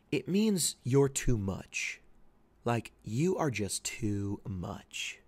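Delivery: slow, emphatic explanation; slow explaining. Shown as a demonstration. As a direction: spell out the meaning slowly. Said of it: "For those of you who don't know".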